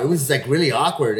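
ordinary delivery

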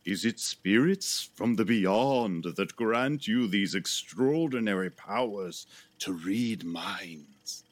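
slow, hushed showman question; TV host voice. Acted. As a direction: ask it with mock awe. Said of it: "And Hinkle says".

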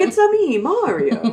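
high, hammed-up Italian accent as Mario; fake Italian accent. Odin in disguise fooling Hel, in a fake Italian accent. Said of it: "a fake Italian accent".

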